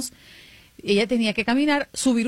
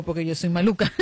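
mischievous; 5 semitones lower than usual. Retold or imitated. Confessing prank mischievously.

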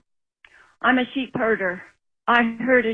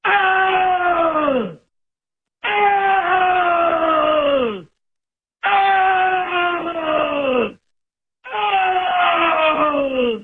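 imitated animal cry; 7 semitones higher, much slower than usual. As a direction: bellow out each cry high like a frightened animal. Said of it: "I heard him go".

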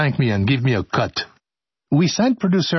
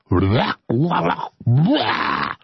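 mock wailing; 8 semitones higher, much slower than usual. Mock-wailing nonsense filler.

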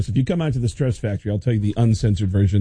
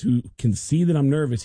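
usual speaking voice

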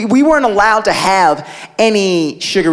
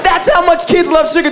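normal talking voice

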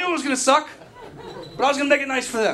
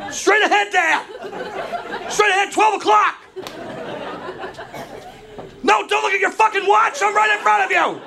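frantic yelling; 10 semitones higher than usual. Retold or imitated. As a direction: scream directions across the curb. Yelling directions to dad.

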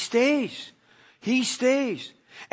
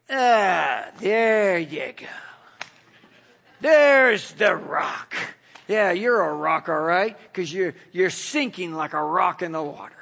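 Jesus mocking Peter for sinking; sarcastic Jesus; 4 semitones lower than usual. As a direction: rub it in with scorn. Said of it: "Did he look at him like".

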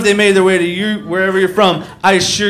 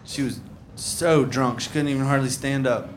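normal talking voice

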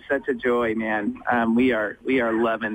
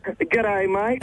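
higher mock Aussie "G'day, mate"; American trying an Aussie accent; Aussie greeting on request; 6 semitones higher than usual. Shown as a demonstration. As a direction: try out the Aussie accent for the host. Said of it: "Can, can you say, "G'day, mate"?".

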